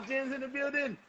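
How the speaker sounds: high, soft echo of a DJ shout; hushed mock crowd call. Echoes the DJ call.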